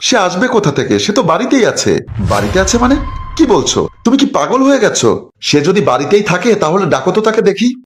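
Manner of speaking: high, disbelieving mother's voice; mother doubting her son's wild claim; incredulous mother. Acted. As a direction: scoff at a son talking nonsense.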